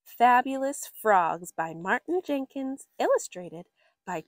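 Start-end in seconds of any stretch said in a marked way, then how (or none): none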